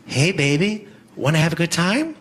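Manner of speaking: lightly voiced come-on; a hint of a streetwalker's tease; hooker. Hooker propositioning a superhero, lightly voiced come-on. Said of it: "A superhero being propositioned by a hooker".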